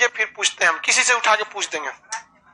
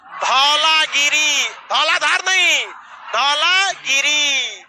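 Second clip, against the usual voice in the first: slow recitation; slower than usual. Shown as a demonstration. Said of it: "अब आते हैं नेपाल में".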